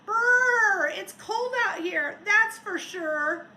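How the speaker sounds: loud, high shivery brr; shivering snowman. Acted. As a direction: shiver through the words.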